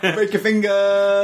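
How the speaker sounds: high and slow, giggling through it; amused echo, laughing; laughing mock threat. Real, not acted. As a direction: repeat the threat while cracking up. Echoing quote while laughing.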